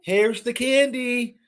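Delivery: slow high coaxing voice; high sly candy offer. Pretend. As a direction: tempt them with candy. Acts out enticing pusher.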